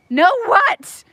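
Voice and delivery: sharp high startled question; surprised. Real, not acted. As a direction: caught off guard. Startled by quail.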